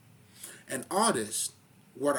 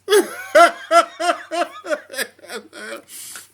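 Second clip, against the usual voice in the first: loud laugh; 15 dB louder than usual. Real, not acted. Laughs at own joke.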